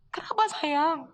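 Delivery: a hint of a tender mother on the phone; mother's voice. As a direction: lightly voice a worried mother.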